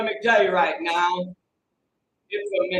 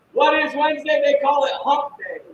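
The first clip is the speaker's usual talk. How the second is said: louder, punchy repeat of the question; loud hype question; 5 semitones higher, 4 dB louder than usual. Acted. Host fires up the viewers, loud and punchy. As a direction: throw it out to the crowd. Hyping up the livestream audience.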